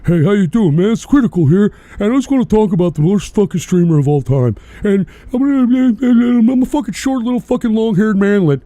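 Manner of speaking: monotone streamer impression, mocking; Critical. Host mocking a rival streamer, droning monotone. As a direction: drone it out in one mocking monotone. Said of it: "talk like some monotone jerk-off".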